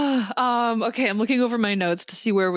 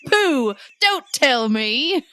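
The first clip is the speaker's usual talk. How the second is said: hammy, badly faked British accent; horrible British accent; 5 semitones higher, slower than usual. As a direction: play the indignant servant in a terrible British accent. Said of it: "forgive me for this horrible accent".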